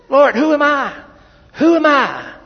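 high, loud cry of doubt; pleading Moses. Acted. Moses crying out to God, high and pleading. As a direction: plead like a man who feels unworthy.

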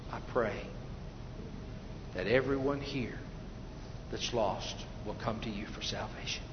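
soft, low prayer with long pauses; hushed prayer. Real, not acted. Preacher prays for the lost to come, hushed prayer. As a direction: pray earnestly under the voice.

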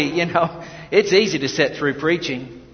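ordinary talk, nothing marked

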